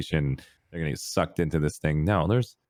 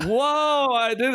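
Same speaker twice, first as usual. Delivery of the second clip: put-on innocent kid; 5 dB louder, much slower than usual. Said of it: "I'm like".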